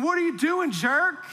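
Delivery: high, pushed mock yell at the driver ahead; a hammed-up snarl of a road rager; mock angry yell. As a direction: imitate the angry driver behind. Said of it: "the guy behind me ... going".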